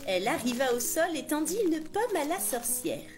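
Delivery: plain reading voice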